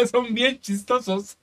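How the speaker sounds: slow, laughing through the words; can barely get it out for laughing. Real, not acted. Co-host laughing at his own zombie joke, laughing while talking.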